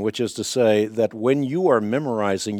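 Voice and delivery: normal talking voice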